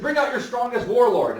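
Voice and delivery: loud, high, bold challenge; commanding demand. Retold or imitated. Houdin challenging the Algerian court, loud commanding call. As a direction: throw down the gauntlet to the court.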